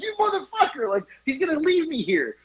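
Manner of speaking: high, laughing protest; mock outraged panic. Storyteller to Eric who won't help, mock panicked outrage. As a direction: complain high-pitched, half laughing.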